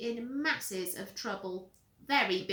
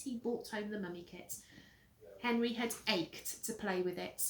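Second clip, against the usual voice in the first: ordinary delivery, nothing marked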